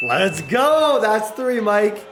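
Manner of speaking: loud, high triumphant yell; real burst of elation; touchdown celebration. Real, not acted. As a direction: cheer the score with full hype.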